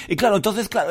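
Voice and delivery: ordinary delivery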